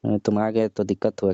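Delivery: ordinary delivery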